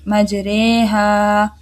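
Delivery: मजरेहा very slow, रे between रा and री; मजरेहा said very slowly, रे with imala; imala demo. Shown as a demonstration. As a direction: stretch मजरेहा with the leaning रे. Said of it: "दरमियानी हालत रे".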